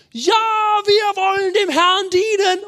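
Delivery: high, loud, drawn-out crowd cry; the people shouting back to Josua, high and eager. Retold or imitated. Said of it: "Sie schreien dann".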